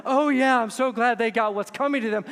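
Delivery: loud, high mock celebration; hammed-up smug cheer; mock gloating.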